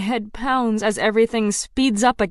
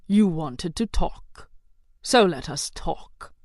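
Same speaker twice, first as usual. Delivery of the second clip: slow, deep, lightly voiced villain; calm menace, drawn out slowly; a hint of a dark king; 3 semitones lower, slower than usual. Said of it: "his voice is deep".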